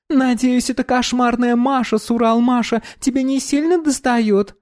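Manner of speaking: put-on bright high young woman; high girl voice. Dominika voiced, mocking.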